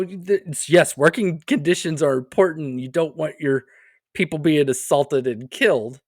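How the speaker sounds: usual speaking voice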